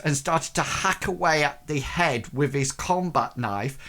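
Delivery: ordinary delivery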